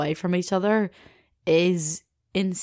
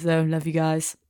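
ordinary delivery

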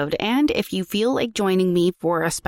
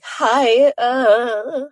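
croaky teen girl attempt; 5 semitones higher, 5 dB louder than usual. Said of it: "I'm supposed to sound like a teenage girl".

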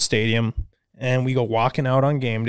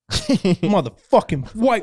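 imitated outburst, higher and drawn out slowly; screaming freak-out; 5 semitones higher, much slower than usual. Homeless man turning on the one who refused him money, screaming freak-out. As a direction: act out the man flipping into aggression. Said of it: "starts screaming, freaking out".

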